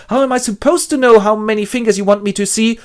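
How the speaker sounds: desperate Winston. Acted. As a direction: plead in despair. Winston protesting.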